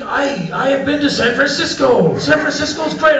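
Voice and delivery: high, enthusiastic mimicry; eager stranger. Iranian stranger tells visiting American of travels, eager and high. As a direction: imitate an excited local, pitched well up.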